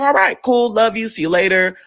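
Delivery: high, breezy send-off; upbeat retold goodbye; cheery goodbye. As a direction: call out a light farewell. Cheery acted goodbye to aunt.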